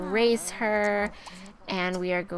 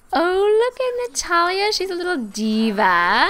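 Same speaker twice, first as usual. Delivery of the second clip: high, loud gushing; excited, high-pitched admiration; delighted exclamation; 9 semitones higher, 6 dB louder than usual. Real, not acted. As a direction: coo loudly over a little one. Delighted by character's look.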